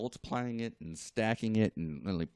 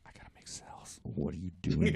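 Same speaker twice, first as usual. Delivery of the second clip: muttered thought; 4 semitones lower, 5 dB quieter than usual. Retold or imitated.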